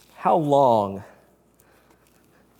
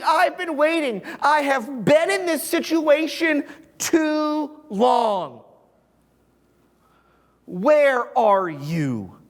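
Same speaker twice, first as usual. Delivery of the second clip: raised, aching plea to God; pleading psalmist; 9 semitones higher than usual. Retold or imitated. Psalmist pleading with God after long waiting, raised and aching. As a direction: cry out like a man who has waited far too long. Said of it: "The psalmist is going".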